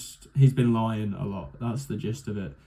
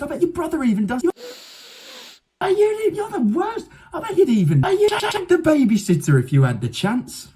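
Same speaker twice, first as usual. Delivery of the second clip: high, loud hammed-up moral rant; laying it on thick; 13 semitones higher, 4 dB louder than usual. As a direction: sneer down from the moral high ground. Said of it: "He lays it on thick".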